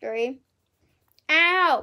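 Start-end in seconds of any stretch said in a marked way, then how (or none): 1.3-1.8 pained yelp. Real, not acted. Hurts during marshmallow challenge.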